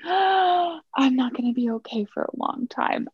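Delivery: high, slow, overwrought victim voice; hammed-up tearful drama queen; mock dramatic sigh.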